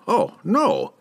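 put-on high eager old wizard, delighted; exclaimed. A narrator's reading. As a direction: gush it like a thrilled scholar. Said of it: "exclaimed the wizard".

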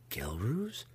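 soft, shocked mumble to himself; mumbled under breath. Acted. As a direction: barely voice the name, mumbling. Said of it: "mumbled under his breath".

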